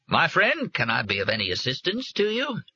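a grand, folksy call out; Mark Twain voice. A narrator's reading.

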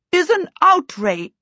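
high, affronted princess; indignant outburst. A narrator's reading. Princess objecting to the chaos on the stairs, indignant. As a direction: declare it in high-pitched indignation.

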